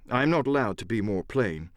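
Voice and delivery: plain reading voice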